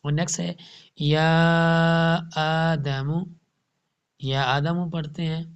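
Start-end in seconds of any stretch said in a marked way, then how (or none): 1.0-3.1 یا آدم slowly with the madd drawn out; Quran recitation. Shown as a demonstration. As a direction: stretch یا آدم carefully. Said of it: "یا کو نہیں long کرتے". (یا آدم صحیح مد کے ساتھ آہستہ)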